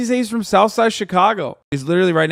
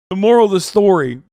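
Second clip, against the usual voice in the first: usual speaking voice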